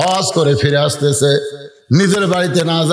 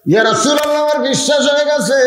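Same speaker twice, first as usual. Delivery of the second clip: high, ringing chanted affirmation; acted fervour, pitched right up; fervent declaration; 9 semitones higher, faster than usual. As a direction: proclaim belief in a high preacher's cry. Declaration repeated as exultant high cry.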